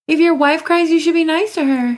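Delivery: mock scolding. Real, not acted. Wife lectures husband playfully.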